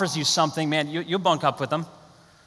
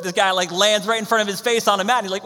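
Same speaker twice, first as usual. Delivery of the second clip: ordinary delivery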